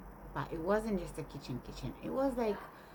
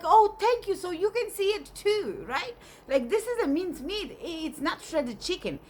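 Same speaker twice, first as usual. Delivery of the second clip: high, loud, animated arguing; acted-out triumphant protest, pitched high; vindicated retort; 10 semitones higher, 5 dB louder than usual. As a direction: seize on the support and press the point. Dreamer gains an ally in argument.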